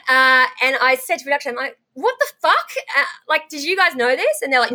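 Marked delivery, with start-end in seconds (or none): 1.9-4.4 high, stunned outburst at the crew; reliving the shock of the news; disbelieving cry to the producers; shocked disbelief. Retold or imitated. Shocked to learn of assault.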